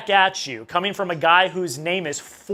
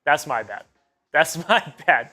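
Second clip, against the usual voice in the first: ordinary delivery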